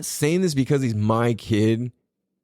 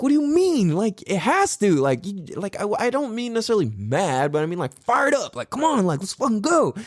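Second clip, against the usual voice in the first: higher, insistent rally; retold pep talk; 7 semitones higher than usual. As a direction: pump him up, half-joking. Arguing son must get fired up.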